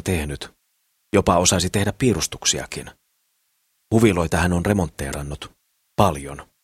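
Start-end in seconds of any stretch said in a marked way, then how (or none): none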